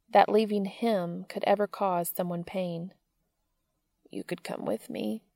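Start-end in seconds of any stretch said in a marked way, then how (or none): 4.1-5.2 softer, gently hopeful question. A narrator's reading. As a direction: ask softly, hoping for a yes.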